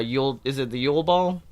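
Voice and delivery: ordinary talk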